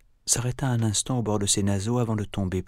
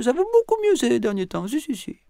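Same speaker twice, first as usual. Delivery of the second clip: dame Mila rassurante; 6 dB louder than usual. Acted.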